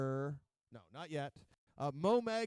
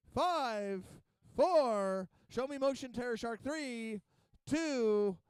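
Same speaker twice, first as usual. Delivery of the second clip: higher, called-out countdown to the arena; announcer countdown; 6 semitones higher, slower than usual. Real, not acted. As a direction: count them down like a ring announcer. Live arena countdown calls.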